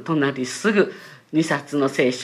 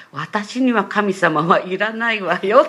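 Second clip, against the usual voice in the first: louder, higher retold refusal; きっぱり拒絶; 5 semitones higher, 8 dB louder than usual. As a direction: shut the subject down firmly, voicing the mother. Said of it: "ピシャーっと心のシャッターを下ろして". (母のきっぱりした拒絶を再現)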